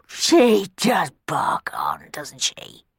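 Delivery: slow whispered grumble; grumbling under breath. Acted. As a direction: grumble it so she can't hear.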